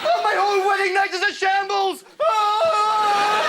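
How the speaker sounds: hammed-up despairing shout; anguished wail. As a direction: wail it out loud. Bride wails at the ruined night.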